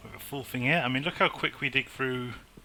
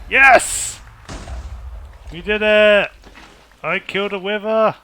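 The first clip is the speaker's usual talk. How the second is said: high, loud triumphant cheer; victory shout; 7 semitones higher, 9 dB louder than usual. Real, not acted. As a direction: yell it out in real triumph. Boss killed, victory cheer.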